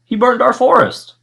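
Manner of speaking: high, loud accusation; cried out. Acted. The king cries out blaming the dragon. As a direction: cry it out indignantly. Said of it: "he cried".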